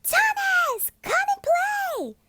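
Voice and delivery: high, loud call from far off, drawn out; yelled from afar. A narrator's reading. A kid calling Thomas from the playground, far off. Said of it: "someone yelled".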